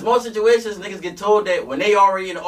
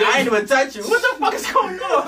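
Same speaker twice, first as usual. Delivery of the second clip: panicked; 11 semitones higher than usual. Retold or imitated.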